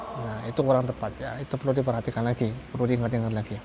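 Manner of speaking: ordinary talk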